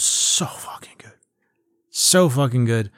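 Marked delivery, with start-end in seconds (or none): 0.0-1.1 whispered in amazement; soft, reverent whisper; awed whisper. Real, not acted. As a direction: drop to a hushed, marvelling whisper. Marvelling, then repeats it voiced.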